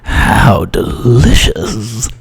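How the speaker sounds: very slow, sly and creepy; creepy Guy savouring the words, very slow; sneaky. Acted. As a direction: ooze it out like a creepy schemer. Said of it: "I gotta be sneaky on that one".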